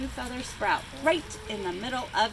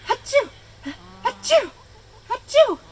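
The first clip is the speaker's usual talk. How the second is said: loud, high sneezes in a row; 13 semitones higher, 11 dB louder than usual. A narrator's reading. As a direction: sneeze hard three times. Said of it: "began to sneeze".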